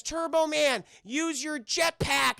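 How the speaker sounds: high, stretched-out crowd cry; acted calling out. Crowd urging Turbo Man on, hammed-up calling. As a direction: call up to a hero in trouble.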